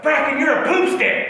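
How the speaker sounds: high-pitched jeering friends; mocking taunt. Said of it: "They're making fun of you".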